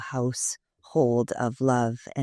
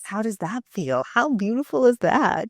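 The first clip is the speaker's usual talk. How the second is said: higher, brighter delight; excited praise; 8 semitones higher than usual. Real, not acted. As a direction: gush about the award nomination. Interviewer enthused about award.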